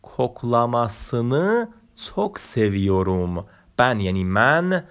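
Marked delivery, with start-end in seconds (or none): 0.0-3.4 کوکلاماسنı çok seviyorum read slowly word by word; slow Turkish reading. Shown as a demonstration. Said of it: "ببین".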